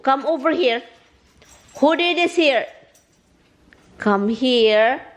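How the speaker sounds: high, insistent summons; scolding pets. Real, not acted. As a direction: call the culprits over in a high scolding voice.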